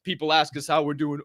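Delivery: ordinary delivery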